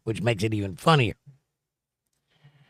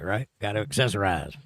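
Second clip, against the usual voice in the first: ordinary talk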